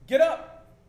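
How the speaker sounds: sudden loud, high shout; urgent, full-volume command. Acted. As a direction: bellow an urgent wake-up order. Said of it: "a little bit of urgency".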